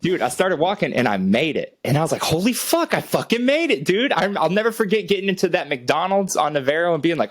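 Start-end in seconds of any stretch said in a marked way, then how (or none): 2.2-4.1 excited relief. Retold or imitated. Relief after long walk.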